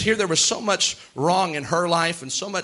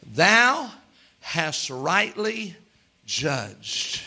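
solemn verdict; much slower than usual. Acted. Jesus answering Simon in a slow, solemn King James verdict. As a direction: deliver it like a final judgment.

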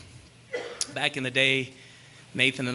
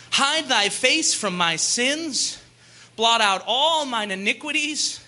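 raised, emphatic Bible reading; scripture reading; 9 semitones higher than usual. Acted. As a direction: declaim the psalm from the pulpit. Reads the psalm aloud.

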